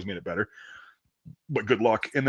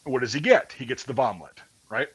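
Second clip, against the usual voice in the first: normal talking voice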